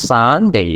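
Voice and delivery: সানডে with a long 'saa', 'saan-day'; drawn-out Sunday. Shown as a demonstration. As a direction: stretch the first vowel of সানডে. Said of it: "এটা একটু টান দিতে হয়". (একটু টান দিয়ে 'সা-ন-ডে')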